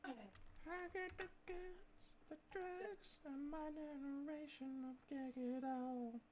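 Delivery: lightly voiced menacing villain, high and soft; a hint of a trailer baddie; mock trailer villain. Quoting a played trailer line.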